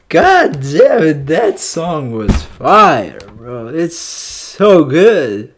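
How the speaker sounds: slow, loud, high praise; raving about the song to viewers; slow awed raving. Real, not acted. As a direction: savor every word, amazed.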